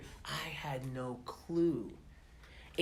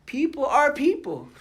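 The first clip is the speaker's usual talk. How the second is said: laughing; 11 semitones higher, 8 dB louder than usual. Real, not acted. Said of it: "laughing emphasis on the point".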